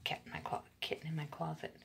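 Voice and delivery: soft whisper to the cat. Real, not acted. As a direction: hush it under your breath. Aside to the cat.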